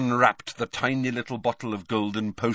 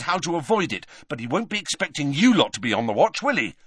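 higher, pressing instructions; urgent Harry; 8 semitones higher, 3 dB louder than usual. A narrator's reading. Boy briefing friends to keep watch, urgent. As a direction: drive each instruction home.

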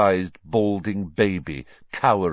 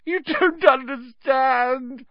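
very high, slow, squeaky whine; drawn-out high-pitched wail; whining; slower than usual. A narrator's reading. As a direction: whine it pitifully. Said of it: "whined Pettigrew".

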